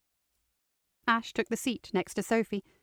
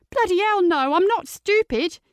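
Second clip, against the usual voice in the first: loud, high, defensive cry; indignant outburst; 10 semitones higher, 7 dB louder than usual. Acted. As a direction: burst out, offended and alarmed. Teen denies in alarm.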